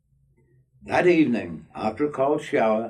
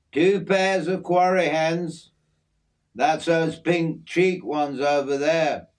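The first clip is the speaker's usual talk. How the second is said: a lightly voiced friendly Aussie bloke, slightly raised; easygoing outback mate showing off his aviary; hint of a broad Australian working man; Aussie bloke Jim; 9 semitones higher, 4 dB louder than usual. Jim lists the birds.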